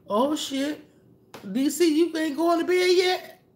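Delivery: delighted laughing yelp at a returning friend; laughing surprise. Real, not acted. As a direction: squeal it through a laugh, caught off guard. Surprised a viewer is back.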